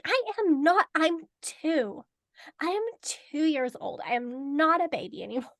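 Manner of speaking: put-on high, loud childlike protest; indignant young hatchling. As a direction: insist like an offended little one. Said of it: "And she's like".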